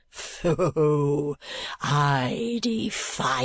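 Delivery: put-on high, slow, theatrical old suitor, crying out; a lovestruck eccentric declaiming his rhapsody; an old man raving with adoration; rapturous old gentleman. A narrator's reading.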